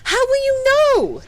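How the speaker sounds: high, loud, alarmed cry; shocked outcry. Acted. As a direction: cry out in disbelief. Shocked outcry at death prophecy.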